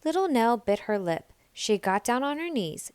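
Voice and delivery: ordinary delivery, nothing marked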